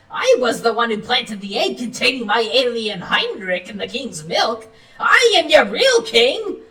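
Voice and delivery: high-pitched, gloating villain; Professor Von Cripplespeck. Acted. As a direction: proclaim it high and scornful, building to a triumphant claim.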